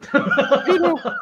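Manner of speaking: high laughing "Pedo"; a giggled "Pedo". Pretend. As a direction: laugh as you say it. Repeats with laugh.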